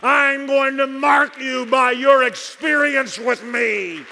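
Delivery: loud, high, shouting preacher climax; preaching at full force. Acted. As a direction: hammer it home to the congregation, shouting.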